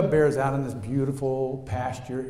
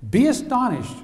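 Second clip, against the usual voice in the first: higher, louder "Be astonished" hit hard; preached command; 11 semitones higher, 7 dB louder than usual. Shown as a demonstration. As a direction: call the church to astonishment. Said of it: "church, I'll say church".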